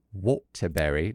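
drawn-out posh "Waterbury"; slow, clipped British "Waterbury". Shown as a demonstration. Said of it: "just have to say it".

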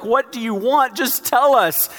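put-on begging a baby to explain itself; exasperated plea. Frazzled parent pleads loudly with a crying newborn.

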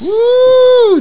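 high, loud whoop; excited whoop. Real, not acted. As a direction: whoop it out, thrilled. A tough question gets read out.

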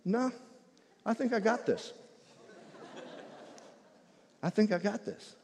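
lightly voiced man turning down prayer; mimicking the man. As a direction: imitate a smug refusal. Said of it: "he just looked at me and he went".

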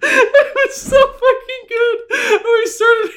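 high, slow, wheezing with real laughter; laughing hard. Real, not acted. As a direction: struggle to talk through the giggles. Helpless laughter after the story.

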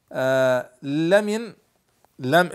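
ordinary talk

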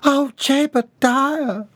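high, slow exclaim; scandalised Bobby Sue. A narrator's reading. Bobby Sue scandalised by Jeb, high slow cry. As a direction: gasp his name in shock.

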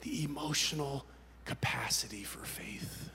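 slow, hushed, drained admission; soft, halting confession in prayer; confession to God. Retold or imitated. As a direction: nearly whisper it, word by word. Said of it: "I said to God".

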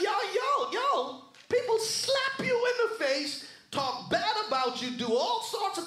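high, ringing pulpit shout; exclaiming at full pitch, word after word; preacher's cry. Acted. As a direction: cry it out high to the whole church. Preacher cries out Paul's words.

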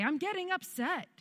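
high, singsong-like warning; high-pitched announcement. Retold or imitated. Mother telling her kids how she feels now, high-pitched.

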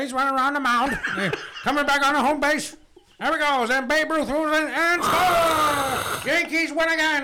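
loud, high vintage play-by-play; old-school baseball announcer. Pretend. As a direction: call the game excitedly, stretching the climax. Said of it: "fucking old school baseball announcer".

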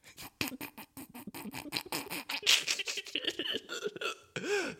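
high laugh of disbelief; a burst of fed-up laughter; exasperated laugh. Real, not acted. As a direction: laugh it off in frustration. Laughs after audio failure.

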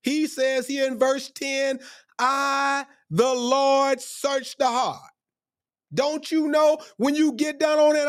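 very high, sustained preacher's chant; impassioned preaching. Real, not acted. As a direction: drive the verse home high and drawn out. Preaches the verse at full pitch.

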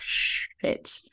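a hushed, breathless whisper of panic; mock-panicked whispered swear; whispered curse. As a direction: whisper it like you've just been caught out. Mum caught out by the elf.

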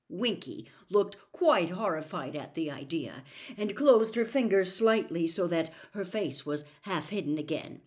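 usual speaking voice

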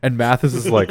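normal talking voice

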